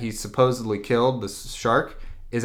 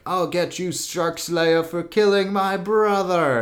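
high, hammed-up vengeful threat; staged-fight threat; 7 semitones higher than usual. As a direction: play a badly faked avenger. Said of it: "they have this huge staged fight".

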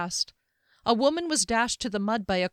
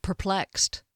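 ordinary delivery, nothing marked

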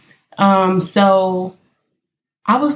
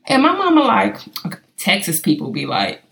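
ordinary talk, nothing marked

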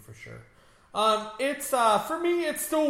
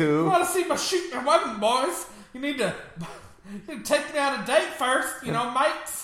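higher, laughing mock Australian voice; mock Australian Spider-Man; 7 semitones higher than usual. Joking host voicing a flirty Spider-Man in a higher, laughing mock Australian accent. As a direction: play a cheeky Aussie Spider-Man, amused. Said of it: "You're making him sound Australian".

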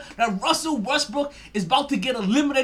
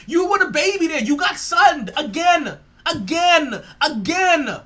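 loud, high jeering; taunting shout; 6 semitones higher, 8 dB louder, slower than usual. Real, not acted. Host mocks the eliminated player with a loud taunt. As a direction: rub it in, louder each time.

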